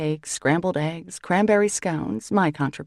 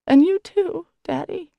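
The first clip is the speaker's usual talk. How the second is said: high, tearful blubber; crying it out, louder and higher; 12 semitones higher, 5 dB louder than usual. A narrator's reading. Daughter crying to her father, high tearful blubber. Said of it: "she blubbers".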